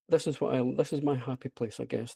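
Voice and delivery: ordinary talk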